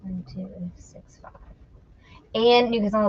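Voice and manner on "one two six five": soft whisper of the numbers; whispered repeat. Real, not acted. Repeating the number from her helper in a whisper. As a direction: whisper it back to memorize.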